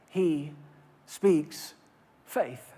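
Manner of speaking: low, soft, slow words; quiet emphasis. Real, not acted. Preacher quietly telling the church what God speaks. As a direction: let the truth land softly. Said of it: "quiet emphatic punchline".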